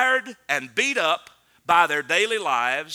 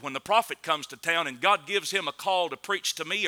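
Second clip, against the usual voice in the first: usual speaking voice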